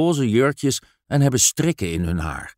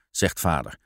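usual speaking voice

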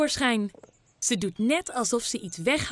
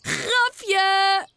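louder gleeful call; boy revealing the prank to papa; loud shout; 7 semitones higher, 5 dB louder than usual. A narrator's reading. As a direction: yell out the punchline. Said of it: "roept Teun heel hard".

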